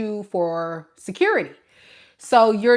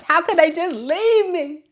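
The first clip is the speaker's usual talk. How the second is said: higher, whining mock complaint; whiny child; 8 semitones higher than usual. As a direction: whimper the complaint like a sulking kid. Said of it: "whining and complaining like a child".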